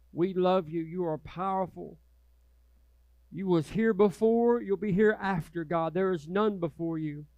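soft, reverent prayer voice. Acted. Pastor praying over the congregation, hushed and reverent. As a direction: pray humbly to God.

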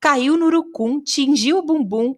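poem reading. A narrator's reading. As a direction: recite the comic rhyme with expression. Recites witch limerick.

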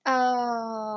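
usual speaking voice

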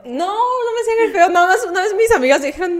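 squirming with laughter at herself; laughing embarrassment. Real, not acted. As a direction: laugh through the denial.

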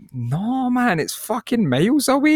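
exasperated answer. Retold or imitated. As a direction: brush off a silly question. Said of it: "You're like".

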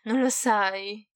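drawn-out taunting question; slow, knowing tease; sneering. A narrator's reading. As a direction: ask it with a smirk. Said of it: "continuò Draco ghignando".

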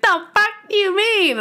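high-pitched defensive comeback; retold squeal of disbelief; mock indignation. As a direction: act out being caught and snapping back. Challenged about her smiling.

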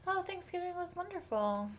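ordinary talk

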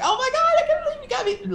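very high, fast, laughing gush; overjoyed mom. Retold or imitated. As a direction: gush through happy laughter, voice way up.

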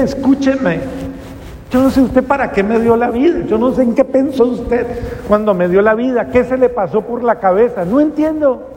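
a desperate plea pitched high and strained; pleading prayer. Retold or imitated. Young addict on his knees begging God for purpose, high pleading.

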